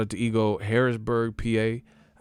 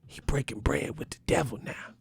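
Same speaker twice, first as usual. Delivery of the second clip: mock storyteller voice; 9 semitones higher than usual. Streamer mocking his fans' super chats, hammed-up storyteller voice. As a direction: tell it like a hammed-up old preacher spinning a tale.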